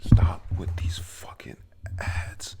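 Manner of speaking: loud, low burst of annoyance; real irritation, forceful and deliberate; exasperated outburst. Real, not acted. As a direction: snap at the interrupting ads, hard and loud. Exasperated outburst at interrupting ads.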